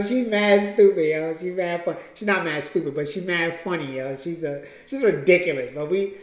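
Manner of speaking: ordinary talk, nothing marked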